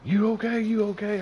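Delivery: high, loud anxious check; panicky, repeat the question fast; worried asking. Retold or imitated. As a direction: foreman frantic over a hurt friend. Panicked foreman checks on him.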